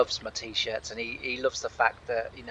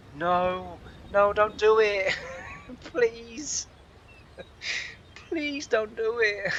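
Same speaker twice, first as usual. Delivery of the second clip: high, drawn-out plea broken by laughs; mock pleading; 8 semitones higher, much slower than usual. Bystander begs two men to stop talking young, mock pleading. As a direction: beg them helplessly through the giggles.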